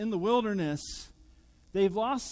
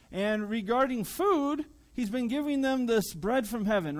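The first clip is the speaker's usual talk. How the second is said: usual speaking voice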